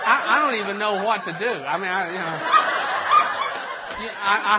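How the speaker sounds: talking through loud real laughter; can barely get the words out for laughing; laughing loudly. Real, not acted. Preacher overcome with loud laughter.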